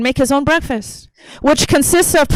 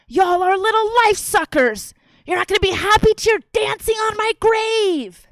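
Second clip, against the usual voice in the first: furious mother impression; 8 semitones higher than usual. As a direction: imitate a mother losing it, stretching each word. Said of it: "she was really fucking mad at us".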